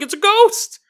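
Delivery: high, loud mock panic; mock alarm. Parents finally realizing it's a ghost, mock panic. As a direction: gasp it out in fake horror. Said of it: "and they're like".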